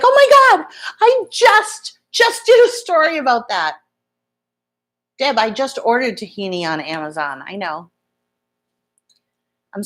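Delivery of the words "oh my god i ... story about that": high, loud retold amazement; excited exclamation. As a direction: exclaim it way up high, easing down toward the end. Retelling her amazed reaction.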